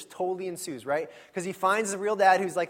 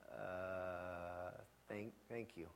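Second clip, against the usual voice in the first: much quieter, low, hesitant stammered thanks; awkward thanks; 11 semitones lower, 20 dB quieter, much slower than usual. Retold or imitated. Exhausted dad answering Buddy's I love you, awkward low stammer. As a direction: fumble the thanks as if caught off guard. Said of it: "And it's super awkward".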